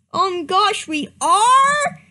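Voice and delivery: high, loud rising disbelief; shocked scream. Acted. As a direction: gasp it out in shock. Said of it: "screamed Starpaw".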